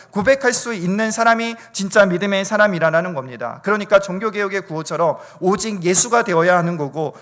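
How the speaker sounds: urgent pulpit exhortation; impassioned preaching. Real, not acted. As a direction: preach it with force, phrase after phrase. Preaches at full force.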